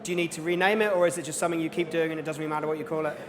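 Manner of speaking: ordinary talk, nothing marked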